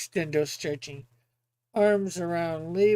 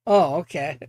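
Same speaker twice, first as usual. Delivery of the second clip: ordinary delivery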